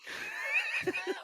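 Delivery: real laugh at a shocking story; burst of laughter. Real, not acted. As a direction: crack up in disbelief.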